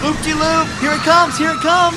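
high, loud thrilled yell; excited shouting. Acted. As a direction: shout it over the ride noise. Shouting on the loop.

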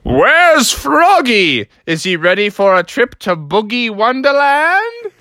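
high, drawn-out calling out; hammed-up singsong fatherly summons; booming dad call. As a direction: holler for your kid, stretching the words. Dad calls for Froggy from downstairs.

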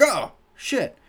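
mock-startled, high-pitched yelp of words. Moviegoer seeing ninjas spin out from behind someone, startled. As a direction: jump in pitch as if ambushed.

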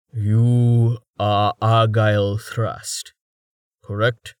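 slow, dry and wry; a hint of a weary giant; lightly voiced gruff old man. A narrator's reading. Torhart's slow deep voice.

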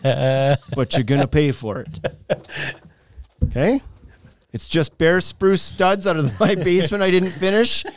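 slick used car salesman. Acted. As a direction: pitch it like a shady contractor. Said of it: "Now you sound like a used car salesman".

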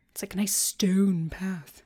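posh hushed whisper. Pretend. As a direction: breathe it like a secret. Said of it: "with that accent there".

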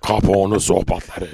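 low announcer voice for a show title; live jingle read. Shown as a demonstration. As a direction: deliver it as a deep radio ident. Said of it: "bir tane canlı jingle çekelim". (pes sesle jingle anonsu)

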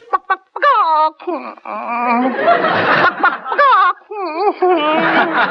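high, far clucking hen; lovesick chicken clucking. Shown as a demonstration. Actor shows the host his lovesick chicken. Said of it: "lovesick chicken".

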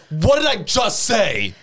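slow, high mock scolding; scolding teacher. Teacher catching a child spinning in chair, mock scolding. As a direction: imitate a teacher's indignant challenge.

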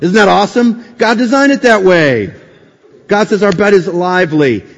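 high, loud excited preaching; exultant. Real, not acted. Preacher exults to congregation about God's design, excited and loud. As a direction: proclaim it with delight, laughter breaking in.

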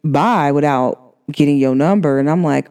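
normal talking voice

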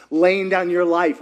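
ordinary talk, nothing marked

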